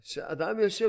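ordinary talk

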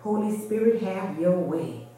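ordinary talk, nothing marked

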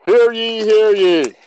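high loud proclamation; town crier. Acted. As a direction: call it out to the whole square. Calling out like a crier.

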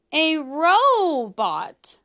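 ROBOT stretched slowly, first syllable held long; drawn-out punchline. Pretend. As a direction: land the pun by dragging out "robot". Punchline of rowing pun.

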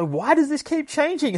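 high-pitched wail of confusion; panicked bewilderment. Acted. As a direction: cry it out, totally baffled. Acts victim's panicked question again.

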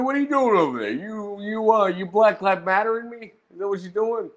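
high-pitched incredulous roast; mocking heckle. Roaster calling out a man on stream, high-pitched mocking. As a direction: needle him with high-pitched questions.

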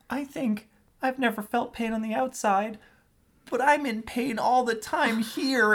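put-on high, slow child's confession; a small boy admitting he hurts inside; sad little Gaara. Young Gaara confides his inner pain to his uncle, high and slow.

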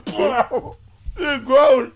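gagging. Real, not acted. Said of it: "I was trying not to".

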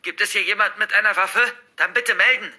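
high, forceful teacher's command; very loud, urgent. Acted. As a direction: take charge of a panicked class. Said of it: "Dabei wurde Frau Roses Stimme sehr laut und sehr eindringlich".

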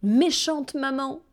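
put-on a hurt child crying out at her mother; upset little girl.

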